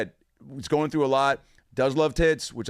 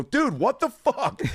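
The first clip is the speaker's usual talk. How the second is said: high, stretched-out disbelieving protest; retold outcry, pitch shooting up; mock outrage; 13 semitones higher, slower than usual. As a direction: react like an appalled frat brother. Brothers' outraged reaction retold.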